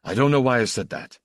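fast, hurried retraction; hasty. A narrator's reading. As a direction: rush to take the words back. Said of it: "He said hastily".

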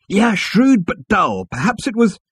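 high, loud and drawn out; Zaphod. A narrator's reading. Zaphod dismissing Arthur's remark, high loud voice.

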